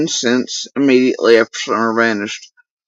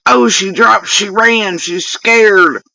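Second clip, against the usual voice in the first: higher-pitched mock chorus of onlookers; mocking the gossips; 6 semitones higher, 3 dB louder, slower than usual. Mimicking people reacting to her leaving, mocking. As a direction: parrot their excited chatter, pitched up. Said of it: "People are like".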